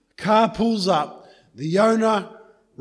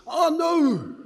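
sudden high gasp of alarm; gasp, then a high panicked oh no; 11 semitones higher, 4 dB louder than usual. Acted. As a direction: caught out and horrified, voice jumping up. Man realises he forgot talc.

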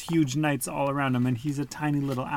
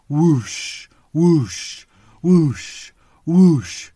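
loud breathy whooshes, growing louder; repeated wing whooshes; 6 semitones higher, 9 dB louder, much slower than usual. A narrator's reading. Dragon's wings approach, loud rhythmic whooshing. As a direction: whoosh it like huge flapping wings. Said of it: "It sounded like a huge bird flapping its wings".

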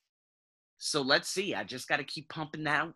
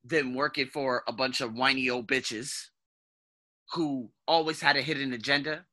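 ordinary talk, nothing marked

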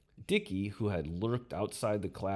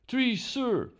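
plain reading voice

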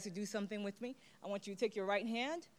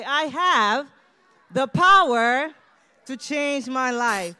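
much louder and higher, slow and forceful; with energy; 6 semitones higher, 13 dB louder, slower than usual. Shown as a demonstration. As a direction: belt it out with full energy. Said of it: "say it with some energy".

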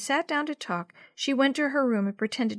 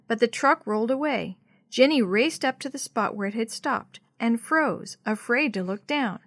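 ordinary delivery, nothing marked

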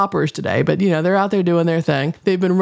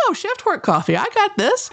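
cocky self; 13 semitones higher than usual. Retold or imitated. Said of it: "I'm like".

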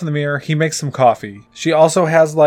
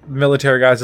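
ordinary delivery

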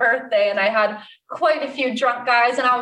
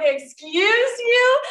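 high, drawn-out disbelief; affronted; 10 semitones higher, much slower than usual. Retold or imitated. Bartender answers the customer's insult, affronted. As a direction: stretch it out as a high-pitched, offended question.

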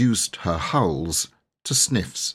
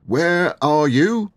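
slow, loud, high call; shout; 9 semitones higher, 5 dB louder, slower than usual. Acted. Servant Andrew shouting to the tower. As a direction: bellow it upward. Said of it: "shouted Andrew".

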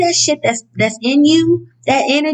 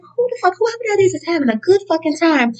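baffled outburst; 6 semitones higher, faster than usual. Retold or imitated. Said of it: "I was like".

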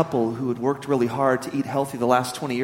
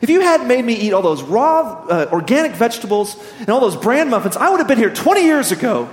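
loud, higher furious complaint at the wife; angry outburst; 7 semitones higher, 6 dB louder than usual. Acted. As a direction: vent the punchline in a mock rage. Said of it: "getting angry".

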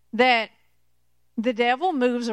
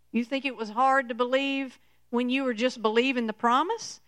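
ordinary talk

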